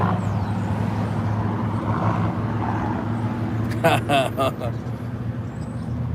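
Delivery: breathy wheezing laugh after the story; long quiet laugh. Real, not acted. Wheezing laugh at his own kidnapping tale. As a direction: let a long, soft, airless laugh roll out.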